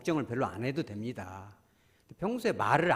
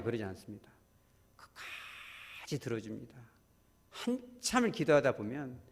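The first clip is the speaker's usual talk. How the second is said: normal talking voice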